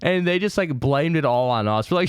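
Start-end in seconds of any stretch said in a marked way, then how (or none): none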